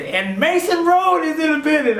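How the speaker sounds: high, hyped announcer imitation; radio host hype. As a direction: announce the group like an excited host. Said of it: "then I hear again".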